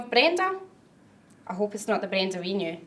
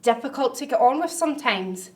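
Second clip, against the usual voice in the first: ordinary talk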